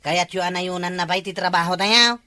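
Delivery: ordinary talk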